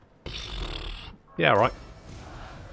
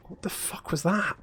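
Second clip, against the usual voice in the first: ordinary delivery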